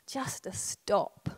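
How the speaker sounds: a slow whisper, letting the words settle; hushed. Real, not acted. Preacher to congregation, about stopping, a slow whisper. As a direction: breathe it out softly and slowly. Urging stillness, drops to whisper.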